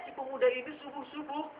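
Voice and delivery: normal talking voice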